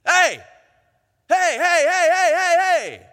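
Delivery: high, loud repeated shouts; shouting after someone. Acted. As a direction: yell to stop people down the road. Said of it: "a shouting match begins".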